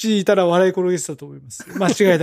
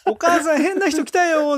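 put-on alarmed kid calling out; child calling mom; 7 semitones higher, slower than usual. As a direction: call out to mom, spooked. Acting a child calling mother.

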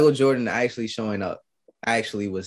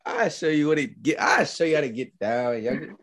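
tipsy Michael Jordan; 4 semitones higher than usual. Retold or imitated. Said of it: "my boy Mike said".

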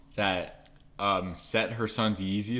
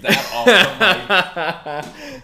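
laughing; 11 semitones higher, 11 dB louder, much slower than usual. Real, not acted. Embarrassed laughing cut.

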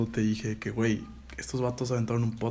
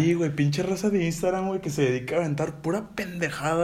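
higher, louder exasperated rant; annoyed rant; 6 semitones higher, 3 dB louder than usual. Real, not acted. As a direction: vent at the Instagram gurus. Ranting about Instagram gurus.

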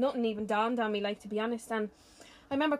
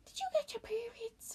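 higher, soft knowing question; mother asking, imitated; 12 semitones higher, 8 dB quieter than usual. Mother quizzing her daughter, soft higher imitated voice. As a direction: ask it like a mother who already knows. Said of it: "she's like".

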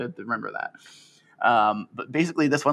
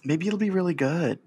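sheepish; 7 semitones higher than usual. Real, not acted. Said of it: "He said sheepishly".

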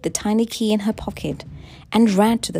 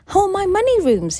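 loud, high-pitched angry demand; overbearing husband, voice raised; growled demand; 12 semitones higher, 7 dB louder than usual. A narrator's reading. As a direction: demand it forcefully of your wife. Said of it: "he growled".

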